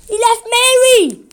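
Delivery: high, loud cry of grief; anguished shout. A narrator's reading. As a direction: wail it out in shock. Farmer finds his dead lamb.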